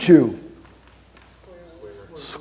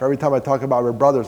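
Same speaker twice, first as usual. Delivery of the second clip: normal talking voice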